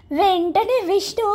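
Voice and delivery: ordinary delivery, nothing marked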